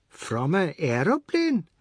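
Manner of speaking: slow, different timbre, incredulous; disbelieving; amazed widow. A narrator's reading. Widow hearing Oad's claim, incredulous.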